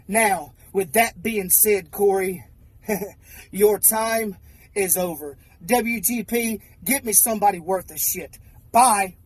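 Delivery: low-pitched, controlled wrestling menace; menacing threat. Acted. Wrestler telling Corey his time is over, low menace. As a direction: drop low and finish off the rival.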